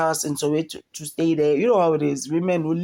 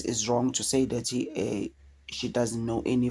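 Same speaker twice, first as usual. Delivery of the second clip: ordinary talk, nothing marked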